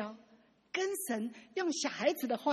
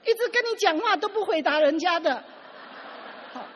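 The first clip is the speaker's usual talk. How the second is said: higher and louder, a fed-up complaint thrown at God; 生气地向神抱怨; 7 semitones higher, 8 dB louder than usual. Retold or imitated. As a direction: slap the Bible down and declare it, exasperated. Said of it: "他就这样很生气的这样". (气冲冲地、提高嗓门对神抱怨)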